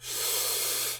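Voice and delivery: long, slow audible breath; deep calming breath. Shown as a demonstration. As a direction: demonstrate a deep breath to calm down. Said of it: "breathe deeply and close your mouth".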